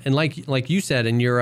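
ordinary delivery

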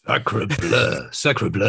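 hammed-up French exclamation, lightly done; playful fake French; mock French accent. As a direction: tease him with a jokey French accent. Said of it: "I was on the phone speaking pidgin French".

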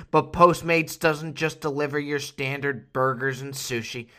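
ordinary talk

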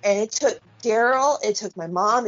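ordinary delivery

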